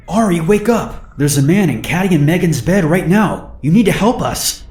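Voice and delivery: panicked urging. Retold or imitated. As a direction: shake him awake in panic. Urgently waking a sleeping friend.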